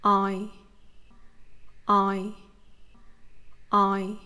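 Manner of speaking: "I" gliding from the first vowel toward the second; Vietnamese diphthong. Shown as a demonstration. Teacher drilling the diphthong for learners, careful and clear. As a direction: model the "I" glide slowly three times. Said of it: "move your mouth to the position of the vowel".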